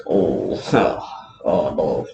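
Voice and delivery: half-laughing groan of realisation; dismayed oh no. Retold or imitated. Roman realising he speared the wrong man, mock dismay. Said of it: "And Roman's like".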